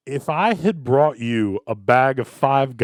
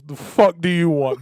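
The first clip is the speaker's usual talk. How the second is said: raised, loud mock-surly cook; imitating uncaring cook; 5 semitones higher, 5 dB louder than usual. Cook greeting a late-night customer, mock uncaring. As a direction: demand it like a cook who doesn't care. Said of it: "Because he doesn't care".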